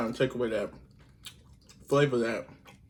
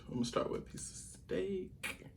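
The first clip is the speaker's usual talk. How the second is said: whispering; 6 semitones higher, 14 dB quieter than usual. Real, not acted. Whispering to camera before eating.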